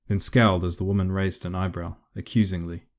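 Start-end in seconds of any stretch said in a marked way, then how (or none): none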